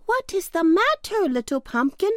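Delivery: high, concerned storybook sunbeams; sunbeams crying out. A narrator's reading. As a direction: call out in a high, caring voice. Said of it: "they cried".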